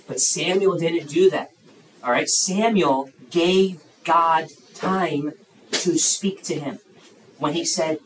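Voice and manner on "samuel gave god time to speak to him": "gave God time" spaced out word by word; slow emphasis. Real, not acted. Preacher contrasts Samuel with giving up, slow and emphatic. Preacher repeats his key point.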